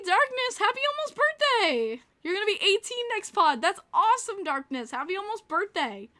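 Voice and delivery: high, loud birthday cheer; real high-pitched delight; excited congratulations. Real, not acted. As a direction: gush happily at a viewer's birthday news.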